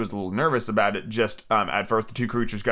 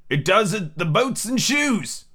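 solemn pronouncement, higher and drawn out; 8 semitones higher, slower than usual. A narrator's reading. Griffin answering Alice, solemnly. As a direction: say it slowly with mock gravity. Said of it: "very solemnly".

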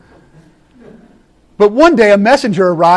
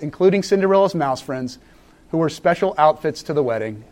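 ordinary delivery

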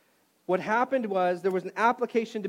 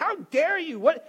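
retold indignation; 8 semitones higher, 4 dB louder than usual. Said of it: "I was like".

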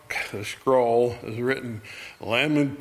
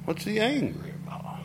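puzzled; 8 semitones higher, 7 dB quieter than usual. Real, not acted. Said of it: "rhetorical question to congregation".

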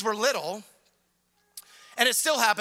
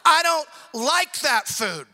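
put-on louder, slow childish whine; whiny child; 3 semitones higher, 4 dB louder, slower than usual. Toddler refusing dinner, loud slow whine. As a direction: drag it out like a sulking toddler.

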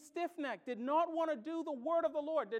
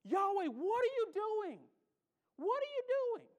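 a hint of angry shouting, pitched up; mock furious Jonah; 6 semitones higher than usual. Jonah complaining to Yahweh, lightly voiced indignant rage. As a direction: Jonah railing at God in disbelief. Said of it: "He was so angry at Yahweh".

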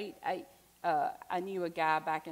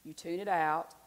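ordinary talk

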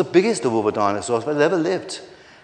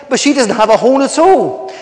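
high, loud objection; indignant storybook rival; indignant protest; 9 semitones higher, 9 dB louder than usual. Acted. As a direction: object in disbelief at the verdict.

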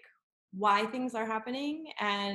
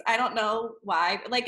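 ordinary delivery

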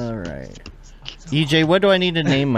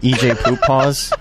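laughing; slower than usual. Real, not acted. Laughing, repeating the joke.

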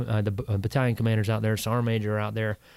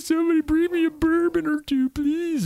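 high, hammed-up begging for bourbon; mock pleading drunk; 3 dB louder than usual. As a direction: whine it out like a wrecked passenger. Retelling his plea on the flight.

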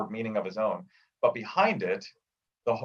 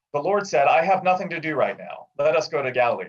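higher, louder, tidy correct grammar; proper formal phrasing; 5 semitones higher, 5 dB louder than usual. Retold or imitated. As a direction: speak it like a prim scribe fixing the text. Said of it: "they'd fix it and they'd say".

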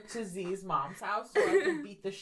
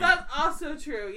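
slow words through laughter; laughing; 5 semitones higher, 4 dB louder, slower than usual. Real, not acted. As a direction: agree while cracking up. Laughing while agreeing.

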